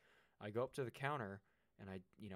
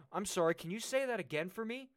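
loud, high mock-polite challenge; retold shout; 11 semitones higher, 11 dB louder than usual. Customer shouting a challenge at the rude fry cook, loud and high. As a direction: lean over the counter and shout it back, daring him. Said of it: "I started shouting".

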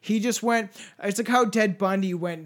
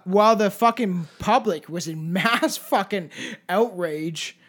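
ordinary talk

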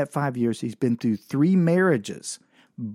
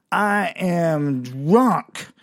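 put-on very slow, higher, drawn-out drunken announcement; drunk woman; 4 semitones higher, much slower than usual. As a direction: stretch every word like a hammed-up drunk. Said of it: "I immediately knew she was drunk".

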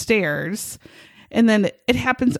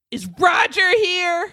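mock stranger; 7 semitones higher than usual. Retold stranger at door, measured.

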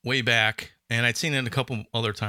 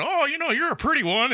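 much higher, laughing lecherous coo; leering old man; 13 semitones higher than usual. Retold or imitated.

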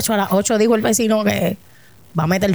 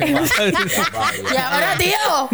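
higher, drawn-out Spain Spanish, laughing; mock Spain accent; 4 semitones higher, much slower than usual. Joking to friends about appearing at an actor's house, mock Spain accent. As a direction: put on a playful Spaniard's accent.

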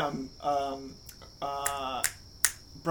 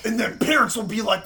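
usual speaking voice

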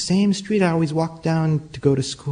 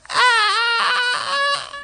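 sudden shrieking scream, very high; a short scream of pure fright; a boy's high scream before fainting; terrified scream. Retold or imitated.

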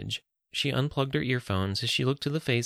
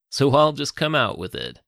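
loud, higher Texan voice; rich Texan accent; 4 semitones higher, 6 dB louder than usual. A narrator's reading. Gunman getting to his problem, Texan accent. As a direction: come out with it in broad Texan. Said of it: "the man continued in his rich Texan accent".

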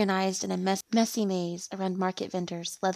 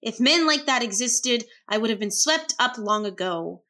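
loud, higher huff on the first word, easing off; huffy; 3 semitones higher, 5 dB louder than usual. A narrator's reading. Woman huffing aloud to herself at the TV, huffy. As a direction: scoff aloud to yourself. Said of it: "I huffed to myself and said aloud".

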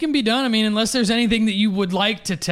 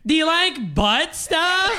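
high, slow whiny drawl, half laughing; Alexis's whiny voice; 9 semitones higher, slower than usual. Retold or imitated. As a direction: whine it out slowly and high. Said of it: "say it in Alexis's whiny voice".

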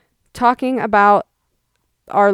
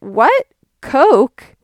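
louder, puzzled "What? Coke?"; louder mock disbelief; surprised mom; 4 semitones higher, 5 dB louder than usual. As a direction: mom baffled by soda at a coffee shop. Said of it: "So she went".

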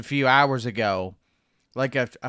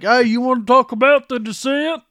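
much higher, louder goofy American voice; mock American; 10 semitones higher, 7 dB louder than usual. British listeners mocking the hosts in a goofy American voice. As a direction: imitate two dumb Yanks. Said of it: "And they're like".

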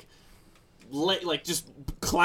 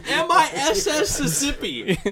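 laughing; 11 semitones higher than usual. Retold or imitated. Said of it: "That's how I'm gonna spell that state".

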